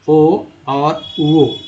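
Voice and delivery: "ओ और वो" stretched very slowly; slowly drawn vowels. Shown as a demonstration. Teacher slowly sounding "ओ और वो" for the class. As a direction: draw out each vowel sound. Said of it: "accent के अनुसार ये".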